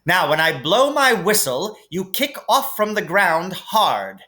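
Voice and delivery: a firm flying instructor giving orders; Madam Hooch instructing. A narrator's reading. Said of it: "said Madam Hooch".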